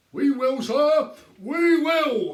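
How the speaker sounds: sergeant placating. A narrator's reading. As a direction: humour the angry man. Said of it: "answered Sergeant Samways".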